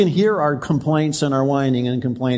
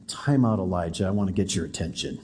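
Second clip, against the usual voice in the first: much lower and softer, calling him to stop; soft voice of God; 10 semitones lower, 7 dB quieter than usual. Acted. God softly calling Elijah to stop and listen. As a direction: hush him gently, like a still small voice.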